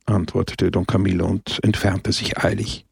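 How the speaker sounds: ordinary delivery, nothing marked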